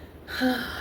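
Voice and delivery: re-enacted deep steadying sigh; long breathy sigh; deep breath. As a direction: take a big audible breath to keep your patience. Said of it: "I took a deep breath".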